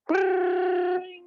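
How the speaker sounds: high trilled brrring; old phone ring. Retold or imitated. Recalling how old phones rang, high trilled ring. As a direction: roll it like an old bell phone. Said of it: "would go like".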